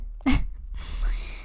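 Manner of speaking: soft unvoiced giggle after an apology; breathy embarrassed laugh. Real, not acted. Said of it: "I'm sorry".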